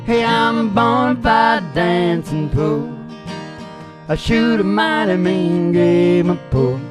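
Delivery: ordinary delivery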